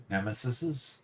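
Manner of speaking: usual speaking voice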